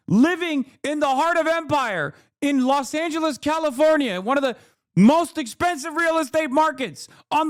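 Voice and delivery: high, furious yelling, really losing it; furious rant. Real, not acted. Streamer yelling at a chat commenter who called him elite, furious high shouting. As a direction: scream it at the idiot who insulted you.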